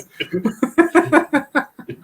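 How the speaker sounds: real high-pitched laughing fit; laughter. Real, not acted. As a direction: crack up at your own joke. Cracking up at own bit.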